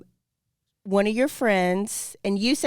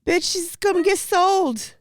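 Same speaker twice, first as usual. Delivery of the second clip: higher, slow alarmed exclamation; higher, slow mock-panicked warning; 9 semitones higher, slower than usual. Real, not acted. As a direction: sound the alarm with a laugh.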